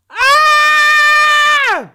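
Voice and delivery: sharp high yelp of shock; shocked shout. Real, not acted. Reacting to a wild tweet: loud high yelp.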